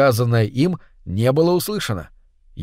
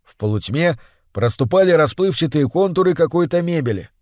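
ordinary delivery, nothing marked

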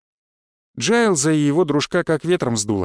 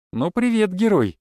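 high-pitched voice of a goddess; teasing goddess greeting; 5 semitones higher than usual. A narrator's reading. Goddess appears in the hero's trance, teasing. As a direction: greet the hero in a higher, playful voice.

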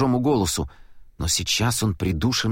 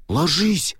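sharp urgent call, higher; exclaiming; 6 semitones higher than usual. A narrator's reading. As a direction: call out the warning. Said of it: "воскликнул Гроувер".

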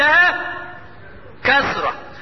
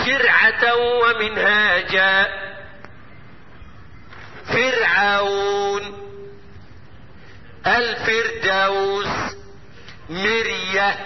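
Quran recitation; 3 semitones lower, 3 dB quieter, much slower than usual. Shown as a demonstration. Recites examples slowly.

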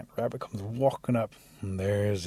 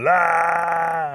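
high, loud, long held cry; mock hollering wail; 7 semitones higher, 9 dB louder than usual. A narrator's reading. Rabbit still hollering in the bushes, wailing loudly. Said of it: "He keeps hollering".

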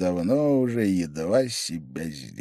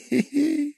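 sly snicker. A narrator's reading. Pavel Pavlovich chuckling at his own quip, high and sly. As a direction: giggle at your own nasty joke.

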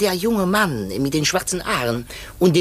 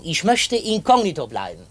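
normal talking voice

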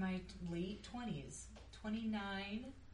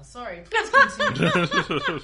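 high, loud laughing apology; 13 semitones higher, 17 dB louder, slower than usual. Real, not acted. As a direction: giggle through the apology.

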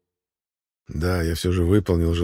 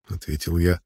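usual speaking voice

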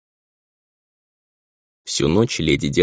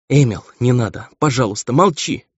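higher-pitched urgent plea; anxious pleading; 5 semitones higher than usual. A narrator's reading. Mother begs delirious son to be silent, anxious. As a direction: beg him to hush, pitch climbing.

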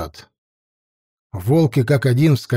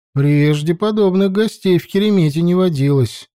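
plain reading voice

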